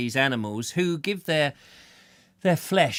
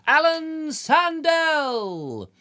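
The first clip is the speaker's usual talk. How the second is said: drawn-out, much higher showman's call of the name; showbiz announcer; 14 semitones higher, 4 dB louder, much slower than usual. Pretend. Host bigging up a guest's name, slow showman call. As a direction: announce him like a Hollywood star.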